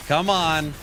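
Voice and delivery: ordinary talk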